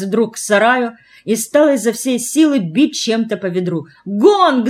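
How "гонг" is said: high, loud announcing shout; crying out. A narrator's reading. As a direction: call out the gong, high and loud. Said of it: "закричала она". (выкрик, высоко и громко)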